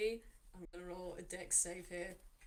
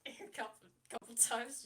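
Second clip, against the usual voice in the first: ordinary delivery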